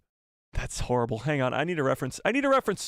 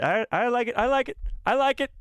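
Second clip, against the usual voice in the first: building excitement; 10 semitones higher than usual. Pretend. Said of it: "mock self praise".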